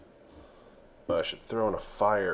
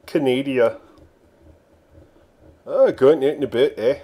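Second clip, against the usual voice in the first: mock Canadian; 4 semitones higher, 12 dB louder than usual. Mock Canadian after German bit.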